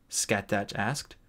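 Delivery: usual speaking voice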